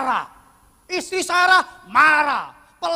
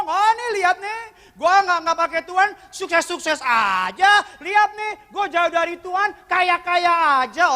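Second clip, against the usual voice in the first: mock proud sinner; 6 semitones higher, 4 dB louder than usual. Proud sinner voiced in sermon.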